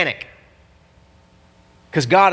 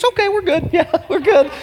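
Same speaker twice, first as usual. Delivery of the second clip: very high, giggly mock relief; pitched-up, hammed-up relief; relieved servant; 14 semitones higher than usual. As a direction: squeak it out with comic new confidence. Acts servant reassured by the army.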